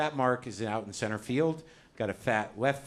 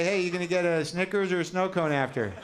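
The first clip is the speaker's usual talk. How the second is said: put-on kid outfielder chit-chat; 6 semitones higher than usual. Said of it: "a little chit-chat with the other guy".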